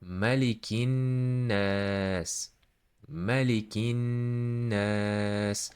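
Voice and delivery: slow "Malikin nas" with a long humming n and long aa; held nun, stretched A. Shown as a demonstration. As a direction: hold the n in "Malikin", then draw out "nas". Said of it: "holding the voice of this nun and stretching".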